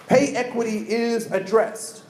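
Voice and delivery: ordinary talk, nothing marked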